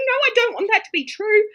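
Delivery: girl fleeing a tarot reading, mock tearful wail; tearful protest. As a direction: cry it out as you run away. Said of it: "in tears running away going".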